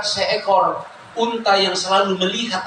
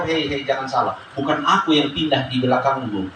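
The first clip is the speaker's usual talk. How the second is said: God answering gently; 7 semitones lower than usual. Acted. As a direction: speak as a calm father setting his child straight. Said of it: "Maka Tuhan akan menjawab".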